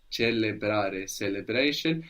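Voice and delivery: slow, each syllable of Celebrare apart; Celebrare, one syllable at a time. Shown as a demonstration. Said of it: "syllable by syllable, slowly".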